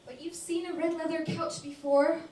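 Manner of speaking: high, loud comic protest; exasperated protest. Acted. Woman trying to stop Bob looking, loud high protest. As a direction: protest loudly, pitch held up. Protesting his wish to look.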